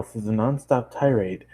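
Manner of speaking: ordinary delivery, nothing marked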